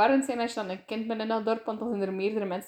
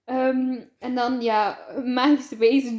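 normal talking voice

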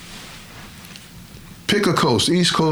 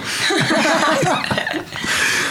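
high rising burst of laughter; real high-pitched laugh; delighted laugh. Real, not acted. Laughs at the professor's joke.

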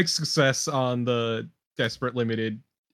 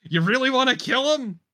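higher-pitched taunting call, only half-yelled; half-shouted in-character challenge; mock yell; 7 semitones higher than usual. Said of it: "I'm gonna yell".

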